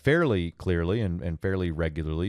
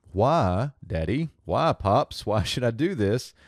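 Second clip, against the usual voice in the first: questioning son; 4 semitones higher than usual. Acted. Son questioning his father.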